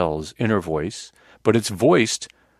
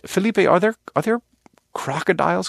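lightly voiced clueless self, pitched higher; a hint of a hapless questioner, voice going up; idiot inner voice; 9 semitones higher than usual. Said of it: "the inner voice that I have is kind of this idiot voice".

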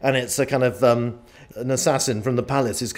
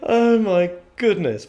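breathless laughing exclamation; 8 semitones higher, slower than usual. Real, not acted. Recovering after the fire alarm.

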